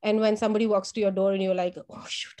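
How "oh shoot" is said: very soft whispered oh shoot; whispered dismay. Retold or imitated. Teacher whispering her dismay at the nurses standing at the door. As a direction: hush it in embarrassment. Caught forgetting.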